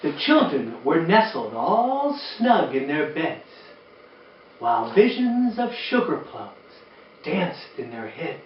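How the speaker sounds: gentle read-aloud storytelling voice; lilting rhymed read-aloud; storybook reading. Acted. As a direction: read it like bedtime to a room of kids.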